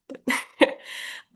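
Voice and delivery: breathy little chuckle; a quiet laugh under the breath; soft laugh. Real, not acted. Laugh after joke.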